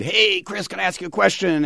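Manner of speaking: lightly imitating a teen calling out; hint of a teen calling to the singer from the crowd; shouted out. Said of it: "my son shouted out".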